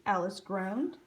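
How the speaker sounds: ordinary delivery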